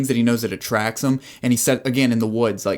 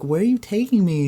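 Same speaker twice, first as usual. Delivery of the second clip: 1 high-pitched quote; 8 semitones higher than usual. Acted. Acting out couple in the car.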